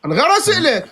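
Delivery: high-pitched exasperated protest; protestation agacée. Real, not acted. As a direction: insist over the opponent, voice pitched well up. Protesting in a heated debate.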